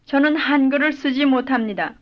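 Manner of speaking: ordinary talk, nothing marked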